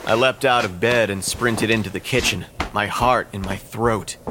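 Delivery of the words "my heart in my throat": usual speaking voice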